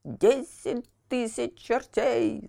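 slow drawn-out villain's oath; hammed-up bellowing puppet master; mock roar. A narrator's reading. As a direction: roar the curse slowly. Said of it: "заорал Карабас-Барабас".

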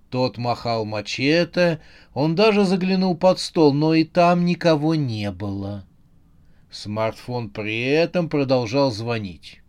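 usual speaking voice

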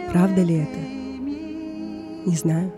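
ordinary delivery, nothing marked

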